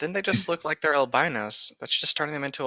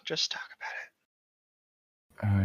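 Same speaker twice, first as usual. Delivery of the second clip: slow, breathy mock-therapist whisper; slow soothing whisper; 5 semitones lower, 5 dB quieter, much slower than usual. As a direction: hush it like a gentle therapist.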